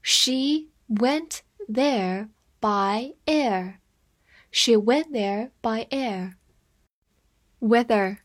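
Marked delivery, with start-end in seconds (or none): 0.0-3.8 very slow, careful sentence reading. Shown as a demonstration. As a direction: draw every word out for learners. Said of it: "我们慢慢来读". (慢速朗读)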